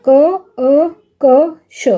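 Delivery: क, अ, क, श as isolated sounds with gaps; sounded out separately. Shown as a demonstration. Teacher splitting कक्षा for pupils, sounded out separately. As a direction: say क, अ, क, श one sound at a time. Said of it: "हमने अलग-अलग कर दिया".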